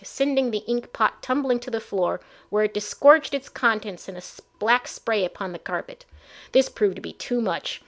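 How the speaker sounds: ordinary delivery, nothing marked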